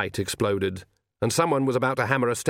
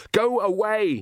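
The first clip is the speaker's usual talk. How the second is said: high, loud scream; 11 semitones higher, 3 dB louder, slower than usual. A narrator's reading. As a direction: scream it at the figure. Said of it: "he screamed".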